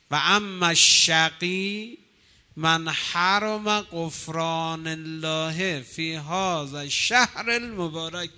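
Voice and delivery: slow, loud, drawn-out chant of the sermon text; solemn Arabic recitation. Acted. Preacher reciting the Prophet's sermon to worshippers, slow and loud. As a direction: intone it slowly to the congregation.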